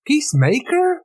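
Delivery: Peacemaker? pitched high and rising in disbelief; you said what?; incredulous question. Real, not acted. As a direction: echo the title back, baffled and high. Baffled echo of title.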